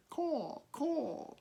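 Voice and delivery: high cawing crow; small high crow call. A narrator's reading. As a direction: squawk it out like a baby crow. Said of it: "he said".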